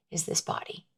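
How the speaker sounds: hushed close to the listener; whispered point. Real, not acted. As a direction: drop to a whisper for weight. Intimate lesson point.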